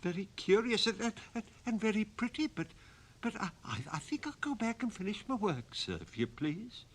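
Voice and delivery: stammering, fast, frightened sexton; stammering fear. Acted. Terrified sexton stammering to the goblin, fast. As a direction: stutter it out, too scared to breathe. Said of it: "The sexton gasped for breath".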